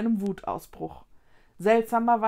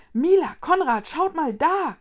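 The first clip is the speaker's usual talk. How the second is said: excited call; 7 semitones higher, 7 dB louder than usual. Acted. Calls friends' attention.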